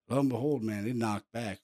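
ordinary talk

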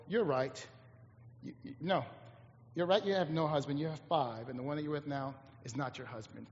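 low, very soft quote; hushed revelation. Acted. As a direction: say it quietly and matter-of-factly. Retelling Jesus's words to the woman.

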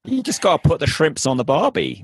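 high, hammed-up Aussie twang; badly faked Australian accent; mock Australian accent. Shown as a demonstration. Guest is asked to demonstrate her fake accent, mock Australian. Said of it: "can you demonstrate that".

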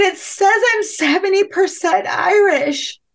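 very high-pitched, hammed-up distressed wail; retold freak-out. Telling husband the test results, retold freak-out. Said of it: "and I'm freaking out".

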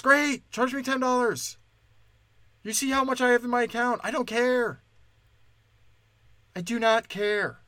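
high, impatient outburst; exasperated retort. Acted. Customer told of a ten dollar charge, exasperated. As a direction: throw it back, high-pitched and fed up.

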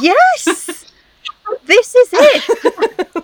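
high, loud laughing cry of recognition; real joy at being understood; excited. Real, not acted. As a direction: shout it with delight and a laugh. Guest bought same chains.

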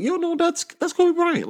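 put-on excited kid. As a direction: future grandkids pointing him out, high eager kid voice. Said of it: "our kids' kids will forever be like".

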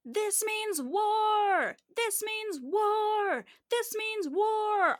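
high, drawn-out rallying shout; loud, high battle cry imitating a pastor; yelling pastor. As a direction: yell it like a preacher whipping up a crowd. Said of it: "she's yelling into the microphone".

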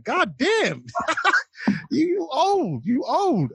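high laughing mock jeer; mocking commenter. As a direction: tease him about his age in a squeaky voice. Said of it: "Somebody was like".

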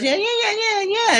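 very high mock-whiny yeahs; Leno voice. Leno mocks the comedian, very high and whiny.

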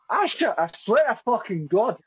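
high, ranting mock Scottish; exasperated Scottish woman at the end of her patience; Angie. Retelling Angie swearing she knows nothing, high Scottish accent. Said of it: "I can't do it Scottish, but she's like".

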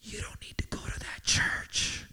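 voiceless tempting whisper; enemy's whisper. Acted. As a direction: breathe it into their ear. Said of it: "he's just gonna whisper in your ear".

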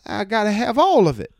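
high, loud put-on shopper voice; high-pitched accented insistence; imitating insistent woman. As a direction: mimic her stubborn plea. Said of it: "And she goes".

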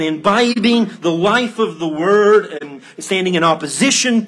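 high, loud preaching at full force; impassioned pulpit appeal; fervent exhortation. Real, not acted. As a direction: urge the congregation on, driving through each phrase. Preaches as Paul exhorting the church.

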